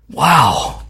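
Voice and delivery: ordinary delivery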